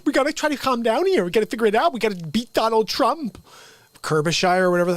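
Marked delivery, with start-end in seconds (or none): 0.0-3.4 much higher, sing-song candidate voice; mock her up-and-down pitch; imitating the lady. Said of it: "And then the lady's like".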